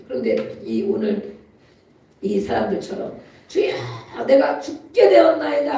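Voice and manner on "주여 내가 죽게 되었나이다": loud, high cry to the Lord; desperate cry. Retold or imitated. Preacher voicing desperate people crying out to God, loud and high. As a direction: cry out as if dying. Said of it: "하고 소리 지르잖아요".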